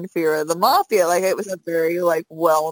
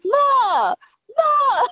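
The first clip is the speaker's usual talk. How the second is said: high-pitched imitation of a mobster's call; mock holler for your mother, giggling; yelling for Mom. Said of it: "yelling for his mom".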